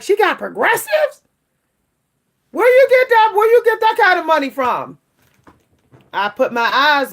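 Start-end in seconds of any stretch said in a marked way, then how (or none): 0.0-5.0 high, loud mock disbelief; imitating nosy viewers, much higher and louder. Viewers doubting she could afford progressives, mock shocked. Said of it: "I know some of y'all like".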